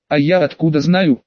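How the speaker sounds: plain reading voice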